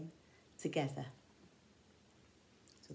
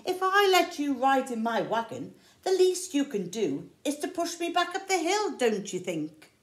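louder, higher bossy bear; mildly hammed-up bossy bear demanding; 7 semitones higher, 7 dB louder than usual. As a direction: lean on them like a bully. Bear bullying his friends.

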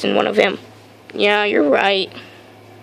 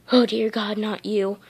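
quiet dismay; 8 dB quieter than usual. Acted. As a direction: say it softly, dismayed at who it is. Dreading the newcomer.

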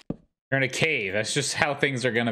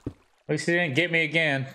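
usual speaking voice

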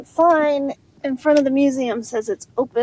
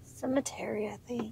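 hushed graveyard whisper; 15 dB quieter than usual. Real, not acted. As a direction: breathe it at the cemetery gates.

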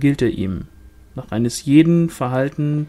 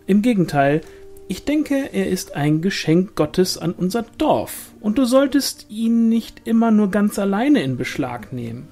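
high, insistent gossip voice; animated, high-voiced village woman; placating sister-in-law; 7 semitones higher, 4 dB louder than usual. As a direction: protest innocence, then gently scold, pitched high. Pauline argues, voiced in narration.

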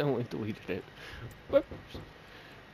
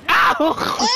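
usual speaking voice

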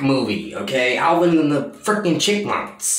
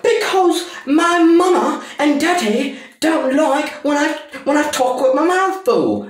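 put-on high, louder whiny child voice; mock whiny kid; 13 semitones higher, 3 dB louder than usual. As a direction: whine it like a little boy with his mouth full. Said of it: "And he's like".

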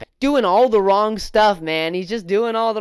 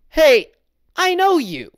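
higher, sudden hey of recognition; mock recognition; 8 semitones higher, 5 dB louder than usual. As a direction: spot him and point him out. Customer recognizing Austin, acted.

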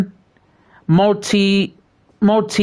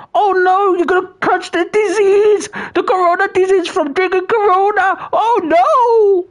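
mock panic; 12 semitones higher than usual. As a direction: shriek it like a pantomime dame in a fright. Mock panic over chat drinking Coronas.

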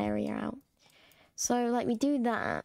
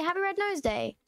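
higher, lilting greeting; raised cheer; 7 semitones higher than usual. Real, not acted. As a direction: lift "Happy Red Nose Day" high, then drop at the end. Repeats greeting with raised tune.

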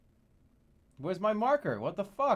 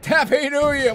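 loud, high holiday holler; triumphant festive yell; jubilant shout; 6 semitones higher, 9 dB louder than usual. Real, not acted. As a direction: belt out the greeting to everyone. Calling out holiday greeting while flying.

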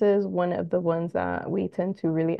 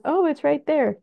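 higher, bright little oh of finding it; pleased discovery; 8 semitones higher, 3 dB louder than usual. Retold or imitated. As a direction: spot it and say so with relief. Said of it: "where you could just quick glance".

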